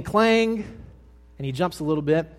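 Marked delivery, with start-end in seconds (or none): 0.0-0.6 high-pitched knife-on-plate clang; imitated clang. Preacher retelling the knife falling again, high clang. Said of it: "this very loud clang sound".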